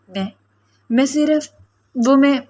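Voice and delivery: halting, with a pause after each few words; faltering voice. A narrator's reading. Girl caught in his room tries to explain, faltering. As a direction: falter and break off, unable to finish. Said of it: "لڑکھڑاتے ہوئے لہجے میں".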